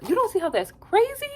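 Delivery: high incredulous squeal; mocking Ace. Quoting Ace for viewers, high disbelieving squeal. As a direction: pitch it way up in disbelief. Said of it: "He really talks like how I talk".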